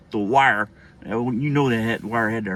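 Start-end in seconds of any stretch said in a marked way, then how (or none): none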